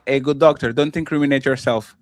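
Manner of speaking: ordinary talk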